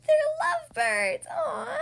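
high, loud delighted gush; gushing excited. Acted. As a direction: squeal it out with delight. Pig gushes about lovebirds.

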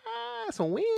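high, soft, hedging reaction; squeaky mock-reluctant shrug; lukewarm concession. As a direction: grant it half-heartedly in a high little voice. Mimics own lukewarm reaction to success.

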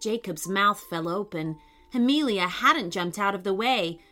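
ordinary delivery

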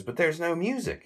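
high put-on lady's voice. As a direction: squeak it like the prim lady. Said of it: "She says".

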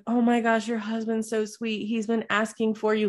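wife imitates the nurse praising her husband, gushing. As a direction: mimic a sweet nurse cooing over him. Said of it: "the nurse comes out and she's like".

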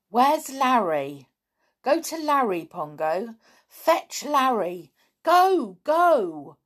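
slow commanding orders to a chimp, high and loud; firm trainer giving a chimp its orders; slow and important. Acted. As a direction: spell each order out slowly for the animal. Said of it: "spoke slowly and importantly".

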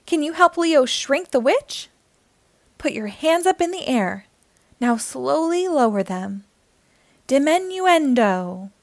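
bright, lively read-aloud voice; reading to children. A narrator's reading. Storyteller reading a picture book aloud to young listeners, lively and bright. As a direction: read it like storytime for little kids.

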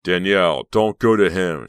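loud warning call; shout. A narrator's reading. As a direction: shout to stop her. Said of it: "she heard Billy shout".

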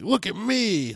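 high, drawn-out attention-seeking cry; mock the look-at-me hero type; Randy Rescue show-off. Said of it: "The, the Randy Rescue idea".

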